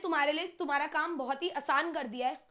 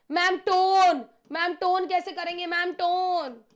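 loud, drawn-out student wail, pitched up; mock worried students; 3 semitones higher, 7 dB louder, slower than usual. Students fretting to their teacher about tone questions, mock-panicked wail. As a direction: imitate students calling out in alarm, louder.

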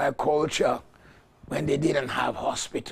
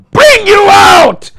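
full-voice shouted declaration; preaching shout; 22 dB louder, slower than usual. Real, not acted. Pastor shouting deliverance over the church. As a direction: bellow it out over the congregation.

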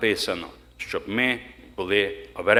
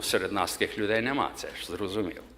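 ordinary talk, nothing marked

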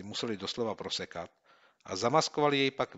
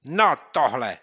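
higher, louder disbelief; indignant gasp; 5 semitones higher, 12 dB louder, slower than usual. Acted. Boy after reading the insult, indignant. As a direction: react in outrage. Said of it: "vydechl Měouk".

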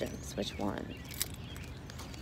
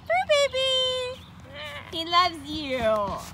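much higher, slow calling pet voice; 4 dB louder, slower than usual. Real, not acted. Owner calling to the cat Purr Baby in squeaky high voice. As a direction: sing the cat's name up high.

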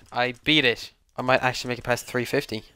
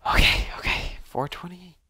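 whispering; 5 semitones higher than usual. Real, not acted. Tense whispering while playing.

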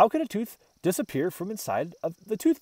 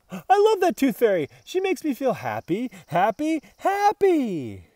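higher and louder, bubbling over with joy; delighted; 7 semitones higher, 5 dB louder than usual. A narrator's reading. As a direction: gush it out, each happy brighter. Dog delighted by the fairy.

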